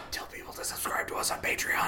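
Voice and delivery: hushed whisper. Real, not acted. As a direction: whisper it like in a library. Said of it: "Why are we whispering?".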